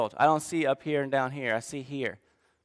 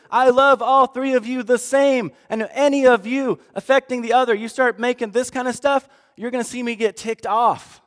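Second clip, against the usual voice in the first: stern angry father; 6 semitones higher, 4 dB louder than usual. Retold or imitated.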